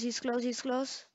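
ordinary talk, nothing marked

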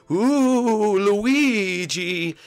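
high, airy little ghost voice; a giddy cartoon ghost greeting a friend. Blimpy the ghost greets Luigi, high and giddy.

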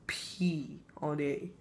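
slow breathy whisper, drawn out. Acted. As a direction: whisper it like a guilty secret. Whispered answer to gross question.

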